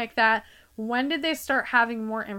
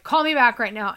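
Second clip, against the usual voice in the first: louder, clipped order from mom; curt demand; 2 semitones higher, 7 dB louder than usual. Retold or imitated. Daughter imitates mom's curt order, louder. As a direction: mom insists he call back at once. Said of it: "and she's like".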